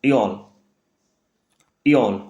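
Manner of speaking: इयोल with rounded ö; German Öl. Shown as a demonstration. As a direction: say इयोल short and falling. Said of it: "ओमिट umlaut से क्या बनेगा".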